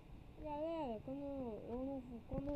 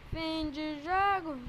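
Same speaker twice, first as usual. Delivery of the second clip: excited commentary; 10 dB louder than usual. Real, not acted. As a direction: shout the last shot. Last attack and final whistle. (narração empolgada e alta)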